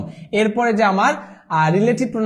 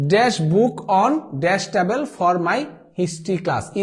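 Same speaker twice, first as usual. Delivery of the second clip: slow dictation read; much slower than usual. Shown as a demonstration. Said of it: "reading the blank sentence aloud".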